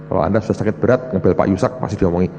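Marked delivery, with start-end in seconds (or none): none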